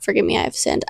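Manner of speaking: hushed whispered prayer. Pretend. Quoting a whispered prayer to God. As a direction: breathe it like a private confession.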